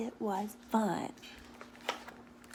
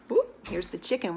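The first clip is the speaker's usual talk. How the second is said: ordinary delivery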